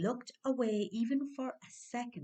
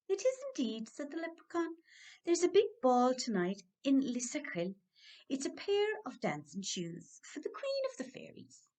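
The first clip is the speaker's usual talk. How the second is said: high, proud little craftsman; leprechaun voice; 6 semitones higher than usual. Acted. Leprechaun proudly telling Dan about the Queen's shoes, high-voiced.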